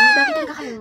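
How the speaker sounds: high, soft "Bakit talaga kayo?". Acted. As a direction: ask it timidly and soft. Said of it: "Sobrang ina ng boses". (mahina at mataas na tanong)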